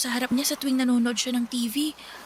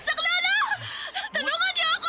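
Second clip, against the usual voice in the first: screaming for help. Acted. Mia screams for help as Harold corners her, desperate. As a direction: scream for rescue with everything.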